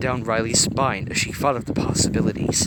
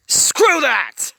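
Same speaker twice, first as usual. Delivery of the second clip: much higher, loud angry bellow; loud, far higher bellow; 9 dB louder than usual. A narrator's reading. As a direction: roar defiance. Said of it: "Anger bellowed".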